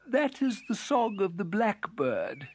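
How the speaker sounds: high, yawny beetle voice; sleepy little beetle stirring; yawning. A narrator's reading. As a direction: speak through a mild waking yawn. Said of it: "yawned the spotted beetle".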